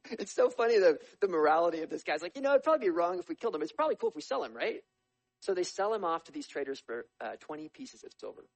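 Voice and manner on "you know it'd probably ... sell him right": fast, high, amused parody of justifying; mock reasoning. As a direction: rattle off the flimsy logic, louder and higher. Mocks Judah's shaky morality.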